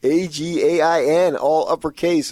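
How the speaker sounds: high, loud, slow spelling out; mock yelling. Co-host echoing an all-caps message, mock yelling. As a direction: shout each letter like capitals.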